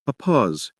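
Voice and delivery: ordinary delivery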